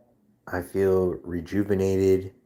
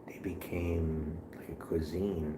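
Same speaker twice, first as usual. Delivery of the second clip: whisper; 2 semitones lower, 11 dB quieter than usual. Real, not acted. Telling chat the lobster story, whispered. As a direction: let it drop to a hushed aside.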